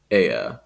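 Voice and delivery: "air" as EH-uh; low, falling "air" ending on uh, no R; schwa ending. Shown as a demonstration. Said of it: "replaced by a schwa".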